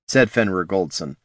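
ordinary delivery, nothing marked

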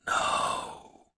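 breath-only, dying ogre's reply; faint, spent whisper. A narrator's reading. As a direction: barely exhale the word. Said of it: "whispered the ogre".

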